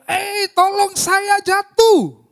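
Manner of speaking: high, long-drawn holler; full-force cry for help. Shown as a demonstration. Preacher acting someone fallen in a pit, desperate high shout. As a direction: yell for rescue with all your strength. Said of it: "pasti dengan sekuat tenaga".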